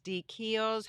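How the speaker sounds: ordinary delivery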